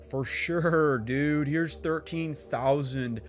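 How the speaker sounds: slow, lazy surfer-dude drawl; an easygoing bro handing over troops; laid-back Woodrow Wilson. Retold or imitated. Woodrow Wilson agreeing to lend troops, as a slow laid-back dude. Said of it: "I'm assuming that's how Woodrow Wilson talked".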